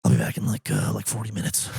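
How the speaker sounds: slouch into his unbothered tone; the guest's voice. Retold or imitated. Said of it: "you have to do the voice too".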